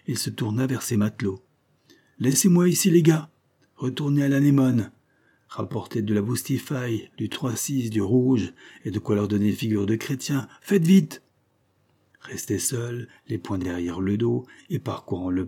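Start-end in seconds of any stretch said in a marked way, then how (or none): 2.2-11.2 raised orders, loudest at both ends; commanding captain. Acted. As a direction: dispatch the sailors briskly. Captain sends sailors for supplies.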